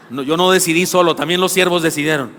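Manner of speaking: ordinary talk, nothing marked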